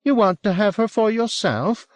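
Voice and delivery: high-pitched, jealous challenge; mistrustful husband pitched high; suspicious accusation. A narrator's reading. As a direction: accuse him in a high, agitated voice. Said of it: "studying Will with some suspicion".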